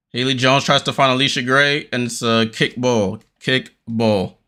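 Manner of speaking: quick running commentary, rising on the action; live play-by-play. Acted. Fan calling the Liberty game live for viewers, quick play-by-play. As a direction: call each pass and shot as it happens.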